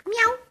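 high little cat meow; playful meow. Pretend. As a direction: mew like a kitten to make the baby look. Mother imitating cat for baby.

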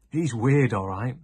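loud mocking scoff. Boy mocks the missing boy to his friends, loud scoff. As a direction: sneer it out, dismissive. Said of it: "scoffed Charlie".

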